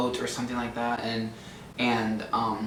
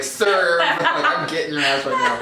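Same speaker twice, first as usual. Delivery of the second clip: strained mock threat; 4 dB louder, slower than usual. Sister threatening a brother in a fight, strained mock threat. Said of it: "like, strain it".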